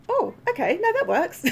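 high, bright "oh okay"; a happy high-pitched turn; delighted realization. Retold or imitated. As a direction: pitch it up, suddenly pleased. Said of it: "with your accent".

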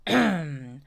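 self-important throat clearing; impressive cough. A narrator's reading. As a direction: clear your throat before a grand speech. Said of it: "Eeyore coughed in an impressive way".

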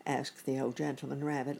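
usual speaking voice

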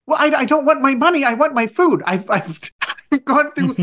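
high-pitched, worked-up customer rant; re-enacting a heated complaint; indignant protest. Customer arguing with corporate over his food, indignant.

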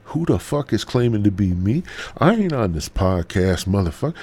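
hammed-up angry outburst; mock outrage. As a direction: flare up as the offended namesake. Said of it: "you heard your name and you're like".

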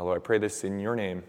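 ordinary delivery

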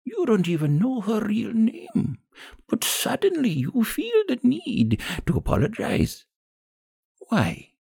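put-on high, gravelly old woman, scornful; high-pitched, reproachful old crone. A narrator's reading. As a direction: rebuke them with disgust as an old woman. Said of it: "The old woman's gravelly voice".

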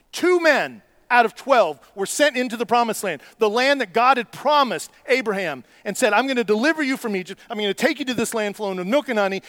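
preaching at full force. Acted. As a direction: build the story up with fire. Sermon retelling of the spies.

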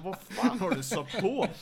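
retold gasp at the blaring music, half laughing; shocked outburst. Teammate reacting to the blasting German rap, startled loud yell.

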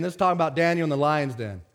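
usual speaking voice

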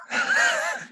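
high shrieking laugh at his own punchline; real high burst of laughter; big burst of laughter. Real, not acted.

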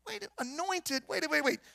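puzzled double take, pitch jumping up; baffled disbelief. Acted. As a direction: stop short in mock confusion. Acting baffled reaction to the scripture.

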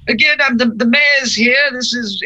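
tiny mayor voice, pitched high; high-pitched character voice. Acted. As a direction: puff up like a little official. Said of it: "It's gonna go".

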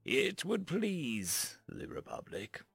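slow, measured and dignified; calm dignity. A narrator's reading. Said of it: "he said with a calm dignity".

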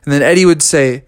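ordinary delivery, nothing marked

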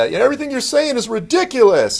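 high mock scorn; mocking critics. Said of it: "prophecy people are like".

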